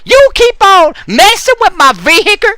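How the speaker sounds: mock furious neighbor; angry neighbor character. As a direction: rant at the guy bugging your car.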